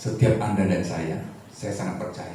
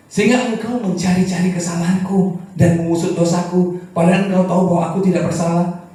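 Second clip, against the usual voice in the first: loud, high, forceful reading of Job's words; preaching Job's complaint; 8 semitones higher, 10 dB louder than usual. Acted. Preacher voicing Job's protest to the congregation, loud and high.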